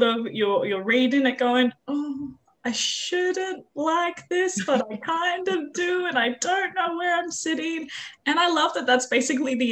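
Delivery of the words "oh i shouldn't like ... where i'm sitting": higher, slow, torn between shame and delight; a mock-guilty higher voice, drawn out; guilty reader's inner voice. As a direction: play the reader who can't admit enjoying it.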